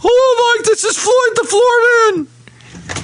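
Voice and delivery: high-pitched silly character voice; Floyd the Floorman. Acted. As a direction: burst in with a squeaky cartoon hello. Host announces himself as Floyd character.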